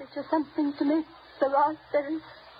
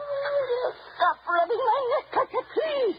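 high, frightened outburst at the gardener; panicked cry; 10 semitones higher, slower than usual. Acted. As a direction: she cries out in panic, pushing him off. Panicked old woman in dark.

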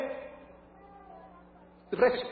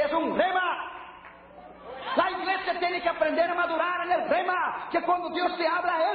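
high-pitched, driving sermon voice; fiery preaching; 5 semitones higher than usual. Real, not acted. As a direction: preach it hard to the church. Preaching at full force.

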